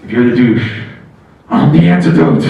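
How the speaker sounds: very low, loud hero growl; superhero catchphrase. Pretend. Comic's punchline, deep loud superhero growl. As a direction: drop it deep like a movie hero.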